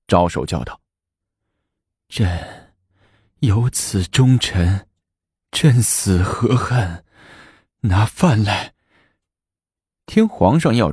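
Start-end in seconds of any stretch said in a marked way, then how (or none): 2.1-8.7 slow, drawn-out regal voice; ailing empress rallying. A narrator's reading. Sick empress sits up, moved by loyalty, slow and stately. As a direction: start faint, draw each phrase out with feeling.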